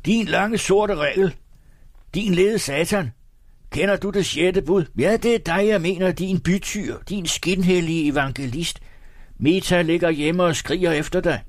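high, sustained heckling from afar; piercing shouts. A narrator's reading. Tipsy photographer heckles from the back, piercing shouts. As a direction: keep hurling accusations in a high-pitched yell. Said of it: "gennemtrængende, vedholdende råb".

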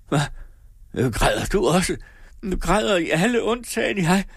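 high, wailing questions; distraught. A narrator's reading. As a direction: cry out in distraught disbelief. Distraught mother asks who weeps.

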